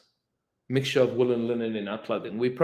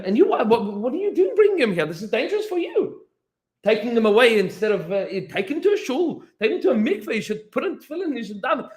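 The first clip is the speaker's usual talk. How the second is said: angry scolding; 11 semitones higher, 5 dB louder than usual. Retold or imitated. Chabad scolds the Talmud.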